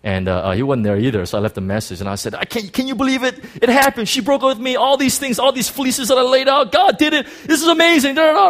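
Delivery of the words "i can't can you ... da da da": much higher and louder, bursting with joy; excited retelling. Retold or imitated. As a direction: gush the news like you can't hold it in. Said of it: "I was just so excited".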